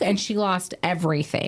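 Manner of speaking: normal talking voice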